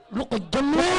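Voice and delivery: usual speaking voice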